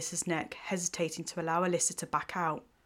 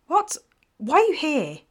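sharp, much higher and louder snap; snippy; 10 semitones higher, 9 dB louder than usual. A narrator's reading. Evelyn snapping at her unexpected visitor, snippy. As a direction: bite off the words, annoyed. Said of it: "The tone of her voice was snippy".

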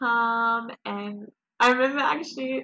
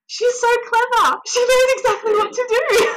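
high, loud, crying through happy tears; tearful joy; 14 semitones higher, 8 dB louder than usual. Real, not acted. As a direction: voice breaking with joy, fading at the end. Overwhelmed retelling baby's birth.

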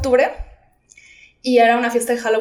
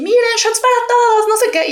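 much higher, hammed-up cheery party voice; mock party guy; 12 semitones higher, 4 dB louder than usual. Friend barging in to lighten the mood, higher and hammed-up. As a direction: play the clueless friend breaking the tension. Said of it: "llegó así".

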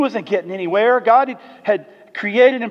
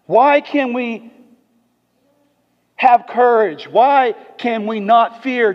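slow rhetorical; 3 semitones higher, 5 dB louder, slower than usual. Real, not acted.

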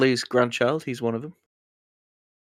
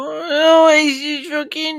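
1 startled yelp; 14 semitones higher, 3 dB louder than usual. Real, not acted. Screen glitch frustration outburst.

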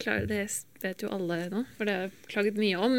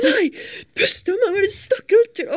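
tearful shout, much higher and louder; retold snapping while crying; 12 semitones higher, 8 dB louder than usual. Stressed student yelling at her dad over the phone, crying and snapping. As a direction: snap back at dad through tears. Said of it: "Og bare kjefter på han".

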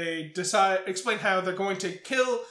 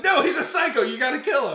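frantic plea; 6 semitones higher, 6 dB louder than usual. Retold or imitated. Randy begging Sydney.

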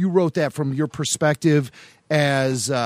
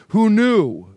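louder, higher "Who knew?" with a playful punch; mock astonished; 5 semitones higher, 4 dB louder than usual. Real, not acted. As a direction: exclaim it as a fun surprise. Amused exclamation after laughing.

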